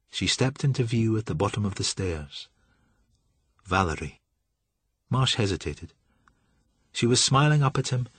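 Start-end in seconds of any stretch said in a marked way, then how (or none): none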